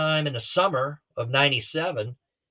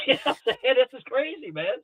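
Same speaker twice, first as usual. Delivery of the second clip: laughing; 11 semitones higher than usual. Real, not acted. Laughing over the retold story.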